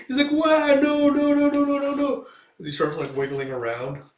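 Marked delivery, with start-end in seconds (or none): 0.3-2.3 very high, loud frantic pleading; panicked wail. Acted. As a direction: squeal in panic as the bolt comes. Elf panics at crossbow.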